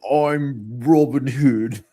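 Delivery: put-on terrible British accent, slow and loud; Kevin Costner. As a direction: drag it out in a hammed-up bad British accent. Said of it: "Kevin Costner had a terrible British accent".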